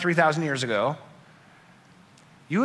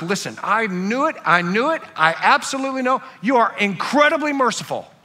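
higher, mock-furious accusation; hammed-up marital outburst, rising in pitch; acted rage at spouse; 6 semitones higher than usual. Preacher voicing a spouse yelling at his wife, higher, hammed-up rage. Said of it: "I mean, you're enraged".

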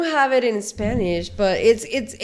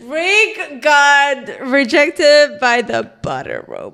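slow mock drama; 4 semitones higher, 5 dB louder, slower than usual. Real, not acted.

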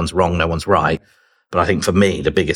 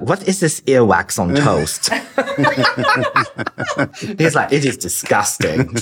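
laughing impression of a German friend; 7 semitones higher than usual. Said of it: "my German student friend".

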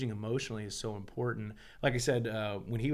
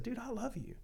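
high, soft and tender; tender love; 12 semitones higher, 7 dB quieter than usual. Retold or imitated. Dad tenderly telling his son he loves him. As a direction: tell him gently while holding him.

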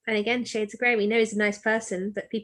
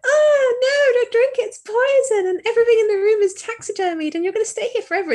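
high, loud panicked warning; frantic warning; 13 semitones higher, 7 dB louder than usual. Retold or imitated. Urgently shouting to the boy not to drink the tea. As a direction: yell a frantic warning at the reader's hero.

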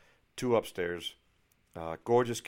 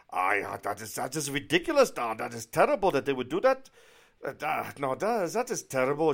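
loud, higher mock German accent; indignant German realtor; 4 semitones higher, 6 dB louder than usual. German realtor hearing how others treat clients, indignant imitated accent. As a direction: mimic a German woman appalled and indignant. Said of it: "tell by the accent she's obviously from Germany".

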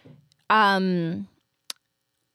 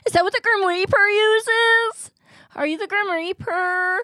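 high, slow mock alarm; 11 semitones higher, 5 dB louder than usual. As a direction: ask in hammed-up alarm. Sword skit.

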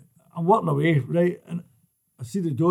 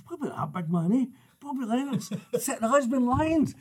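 higher, half-laughing admiring voice; admiring imitation; 8 semitones higher, 4 dB quieter than usual. Next guy in the pub gushing over Bobby Lennox, higher and half-laughing. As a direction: lift the voice up, chuckling with admiration.

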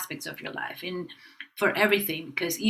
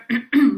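loud, sharp throat clearing; 4 semitones higher, 8 dB louder than usual. Shown as a demonstration. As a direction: demonstrate the bad throat clear. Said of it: "You just never".

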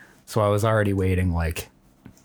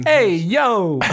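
much higher, louder shouted impression; Ray Liotta; 12 semitones higher, 5 dB louder than usual. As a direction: yell it like a hammed-up Ray Liotta. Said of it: "Ray Liotta impression".

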